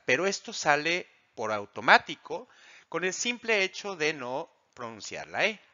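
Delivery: ordinary delivery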